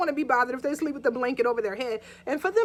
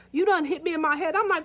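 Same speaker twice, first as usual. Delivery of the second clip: ordinary delivery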